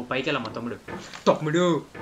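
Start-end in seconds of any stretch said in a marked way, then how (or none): 1.3-1.9 urging call. Real, not acted. Calling character up.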